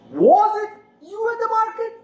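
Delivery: "Was it you at the market" climbing very high; rising question intonation. Shown as a demonstration. Teacher demonstrates a yes or no question, exaggerated rise. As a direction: sweep up from WAS and stay high through MARKET. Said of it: "questions where you can use rising intonation".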